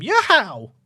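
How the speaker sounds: a quick Italian-flavoured Mario yelp, high and loud; little Mario accent. Pretend. Streamer shouting for his new signing in a Mario accent. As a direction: shout it like Mario celebrating a goal. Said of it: "I've put on a little Mario accent".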